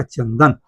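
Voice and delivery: चंदन read with an n sound; anusvara as n. Shown as a demonstration. Teacher concluding the example, चंदन with an n. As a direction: sound the dot in चंदन as n before द. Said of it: "न होगा".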